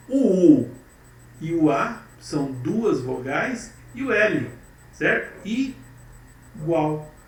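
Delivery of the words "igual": "igual" as "i", long pause, then "gual"; syllable by syllable. Shown as a demonstration. Teacher recapping two syllables, "igual" syllable by syllable. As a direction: separate "igual" into two slow beats. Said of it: "duas sílabas".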